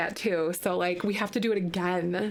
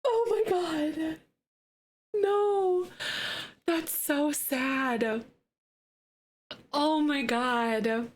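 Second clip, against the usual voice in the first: slow sad; 6 semitones higher, much slower than usual. Real, not acted. Grieving after tragic roll.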